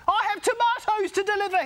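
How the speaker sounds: high, loud complaint; truck driver shouting. A narrator's reading. Truck driver yelling from his cab, high and loud. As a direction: call it out over the horns. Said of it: "he shouted".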